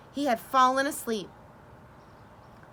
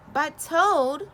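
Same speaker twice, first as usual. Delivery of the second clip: louder, higher plea; crying out; 6 semitones higher, 6 dB louder than usual. A narrator's reading. Frog crying out as Toad returns to bed. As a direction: protest as he goes back to bed. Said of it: "cried Frog".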